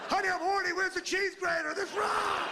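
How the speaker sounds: hammed-up high shout; frenzied mock yelling. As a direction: yell it in absurd, over-the-top excitement.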